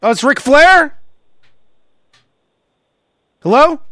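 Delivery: high, loud, suddenly thrilled; light up on recognizing him; excited old fan. Acted. Fan realizing it is Ric Flair, high and loud. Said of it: "I'll show you what it's probably like".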